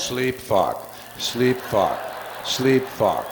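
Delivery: low, steady repeated chant; mock buzzing neon sign; flashing neon sign.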